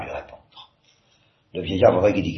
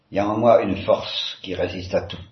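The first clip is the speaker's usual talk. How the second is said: plain reading voice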